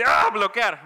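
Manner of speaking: grito agudo de espanto fingido; susto y bloqueo. Retold or imitated. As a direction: reacciona horrorizado al mensaje. Rechaza mensajes de mamá. (grito de susto exagerado)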